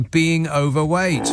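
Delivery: drawn out, deliberate; slow punchline. Real, not acted. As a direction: let the punchline land slowly. Punchline slowed.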